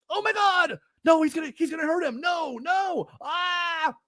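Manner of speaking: high, frantic mock panic; squealing melodrama with no nuance; anime shouting. Mocking the show's nonstop high, panicked, melodramatic shouting. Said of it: "everyone shouting for, like, a half hour".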